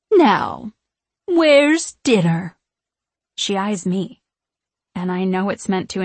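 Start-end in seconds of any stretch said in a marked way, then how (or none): 0.1-2.6 loud, slow, higher sharp witch demand; sharp edge. A narrator's reading. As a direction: bite off each word. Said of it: "Her words gain a sharp edge".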